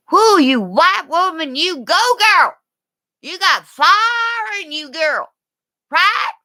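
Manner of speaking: high, loud whoop of encouragement; cheering mom. Acted. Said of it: "silently watching me going".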